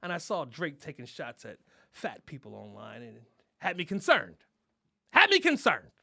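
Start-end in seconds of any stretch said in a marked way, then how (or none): 5.1-5.9 mock concern. Repeating [name] worry for effect.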